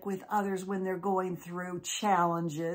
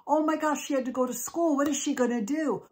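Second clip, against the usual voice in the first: frantic; 7 semitones higher, faster than usual. Acted. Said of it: "just out of her mind".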